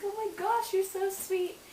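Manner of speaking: high gushing squeal, imitating her past self; gushing delight. Girlfriend retelling thanking her boyfriend for the surprise CD, high gushing delight. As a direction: swoon over the sweet surprise.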